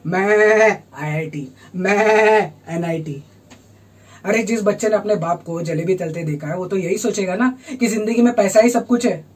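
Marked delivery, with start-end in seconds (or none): 0.1-3.2 low, slow goat bleat on मैं; mock herd-goat chant. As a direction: stretch मैं into a goat's bleat. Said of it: "बकरियों की तरह".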